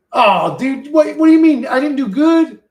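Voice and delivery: high, loud surprised outburst; shocked protest. Real, not acted. Surprised reaction to chat rating.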